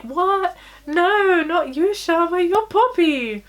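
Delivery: high, loud imitation of shocked friends; mock disbelief. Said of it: "And everyone's like".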